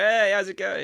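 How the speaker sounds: loud, high-pitched mock greeting; overjoyed greeting. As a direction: hail a new best mate across the bar. Re-enacting drunken greeting of bar owner.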